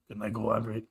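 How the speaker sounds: low, soft imitation of the coworker. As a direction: drop it down under the shushing. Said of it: "kept saying it quieter".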